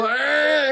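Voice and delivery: high, drawn-out imitated wail; long swelling mock howl; mock wailing scream. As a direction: imitate someone half crying, half screaming. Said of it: "toen begon hij zo van".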